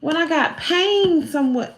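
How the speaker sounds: normal talking voice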